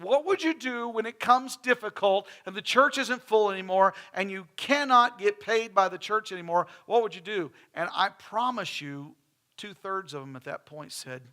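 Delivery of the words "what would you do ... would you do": loud, pressing question to the pastors; challenging question, loud. Retold or imitated. Ministers group asking pastors, loud and pressing. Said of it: "And they said".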